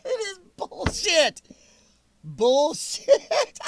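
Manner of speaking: laughs out the words, pitch jumping high; scoffing laugh through the line. Real, not acted. Streamer laughing at the claim, spits it out mid-laugh.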